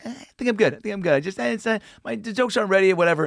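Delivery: high, hedging Larry voice; high dismissive Larry David; Larry David impression. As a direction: wave it off as Larry. Larry declining to go up.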